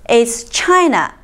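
usual speaking voice

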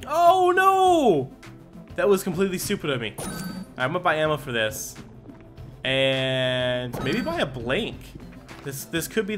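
ordinary delivery

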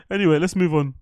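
ordinary delivery

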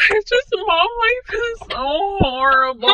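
high, sobbing put-on whine; fake-cry about mom life; mock crying. Mom vlogger wails to viewers about her hard life, mock sobbing. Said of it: "about me crying".

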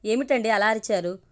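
usual speaking voice

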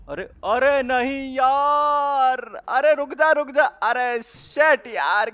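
high, loud, drawn-out protest; dismayed yell. Real, not acted. Gamer protesting as a stranger grabs his turn, dismayed yell. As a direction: wail it as it slips away.

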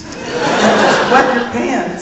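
slow, giggling punchline; laughing through it. Retold or imitated. [name] retelling the boy's advice as a laughing punchline. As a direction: let the laugh take over the line.

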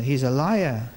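normal talking voice

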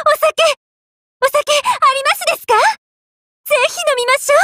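very high, giddy cheering; overjoyed. Acted. Overjoyed at the chance to drink sake, giddy delight. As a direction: squeal with delight over a treat.

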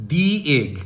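"the" said as "dee" before egg, slow and clear. Shown as a demonstration. Teacher models "the" as "dee" for the vowel in egg. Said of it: "এই জন্য আমরা এখানে বলছি কি".

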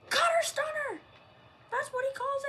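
loud, high finisher call; wrestling commentator. Acted. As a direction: call the move like a ringside announcer.

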